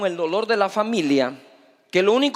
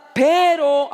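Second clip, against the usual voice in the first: pero called out louder and higher; 6 semitones higher, 5 dB louder than usual. Shown as a demonstration. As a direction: punch out pero so the crowd repeats it. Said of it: "todo el mundo diga fuerte".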